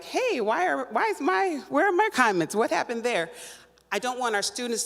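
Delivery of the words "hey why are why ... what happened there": confused indignant quote. Retold or imitated. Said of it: "I was sitting there like".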